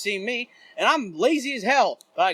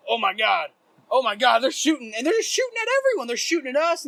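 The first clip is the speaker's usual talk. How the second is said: retold panic climbing higher and higher; freaking out; 5 semitones higher, 3 dB louder than usual. Friends seeing fireworks shot at everyone, retold rising panic. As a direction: babble it in mounting mock alarm. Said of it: "freaking out".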